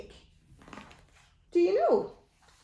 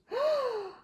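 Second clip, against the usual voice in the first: sharp high gasp; shocked gasp; 13 semitones higher than usual. Acted. Reacting to the thieves' plan for a child, gasping.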